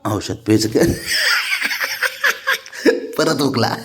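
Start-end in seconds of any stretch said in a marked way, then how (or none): 0.9-3.1 very high breathless laugh. Real, not acted. Owner cracking up at pet, high airy laugh.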